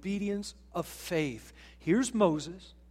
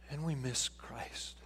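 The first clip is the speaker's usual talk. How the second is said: whispered and slow; 5 semitones lower, 9 dB quieter, slower than usual. Real, not acted. Whispered sermon point.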